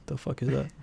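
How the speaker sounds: ordinary talk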